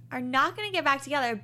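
ordinary talk, nothing marked

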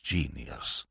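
deep, very soft "Genius"; murmured. A narrator's reading. Old Bittlesham admiring the author, a deep murmur. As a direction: murmur "Genius" low to yourself. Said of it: "murmured old Bittlesham".

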